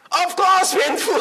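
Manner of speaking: high, exclaimed reply of a mother; emphatic obvious answer. Acted. Mother answering whether birth is painful.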